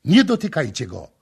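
loud, firm order; commanding call. A narrator's reading. Prince ordering soldiers off the beggar, loud. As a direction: stop them with a command. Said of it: "zawołał do żołnierzy".

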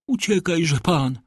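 high, slow plea to flee; urging cry. A narrator's reading. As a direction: call out in pity, pitched high and drawn out. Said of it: "zawołała panna d'Armilly".

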